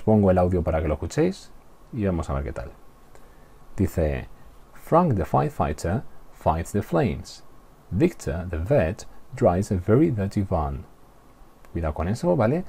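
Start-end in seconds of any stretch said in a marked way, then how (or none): 4.7-10.9 slow tongue twister, Fs and Vs clear in Frank and Victor; trabalenguas inglés modelo. Shown as a demonstration. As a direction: model "very dirty van" slowly with F-like Vs. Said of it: "lo repito yo una vez más". (trabalenguas despacio, Victor y van con V como F)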